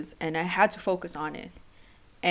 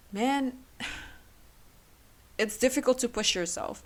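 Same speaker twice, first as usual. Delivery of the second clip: sighing, emotional; 5 semitones higher than usual. Real, not acted. Emotional recollection.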